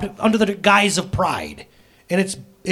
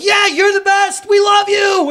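high, loud hammed-up cheering crowd; mock cheering; 7 dB louder, slower than usual. Classmates cheering at a student receiving applause, loud and high. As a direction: cheer like an overeager crowd, shouting. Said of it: "And they're like".

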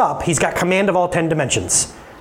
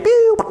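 mock sound effect "pew"; 5 dB louder than usual. Sound of hands parting.

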